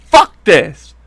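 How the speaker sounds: a hammed-up "fuck this" twice; mock outburst. Mocking Hillary's reaction to the question, angry imitation. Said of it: "she's like".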